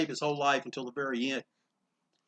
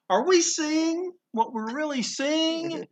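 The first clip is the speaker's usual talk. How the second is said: stunned disbelief; 11 semitones higher, slower than usual. Retold or imitated. Passersby stunned at the sight.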